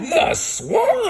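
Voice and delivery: high frightened shriek; scared cry. Acted. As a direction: shriek it like terrified kids. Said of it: "kids cry out in fright".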